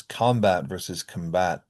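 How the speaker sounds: combat first as COM-bat, then as com-BAT; noun versus verb stress. Shown as a demonstration. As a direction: shift stress in combat from first to second syllable. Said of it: "primary stress on the first syllable".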